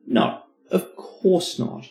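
usual speaking voice

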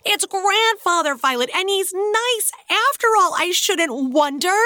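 high, loud, bursting with excitement; excited shout. Acted. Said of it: "dragging his grandfather by one hand and shouting".

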